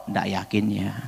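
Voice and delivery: ordinary delivery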